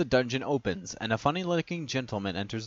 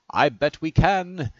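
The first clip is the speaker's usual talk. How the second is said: usual speaking voice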